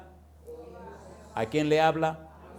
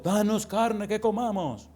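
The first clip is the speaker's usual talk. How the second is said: whining people's plea; 6 semitones higher, slower than usual. Acted.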